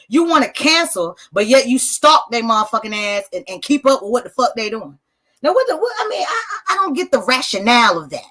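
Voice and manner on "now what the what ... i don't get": exasperated high outburst. Real, not acted. Host on his live stream, baffled by cancel culture, high exasperated outburst. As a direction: voice jumps way up in disbelief.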